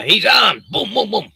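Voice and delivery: high, loud cop shout into gunshot noises; mock alarm shout. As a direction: play a trigger-happy cop yelling, then firing. Mimics police shouting and shooting.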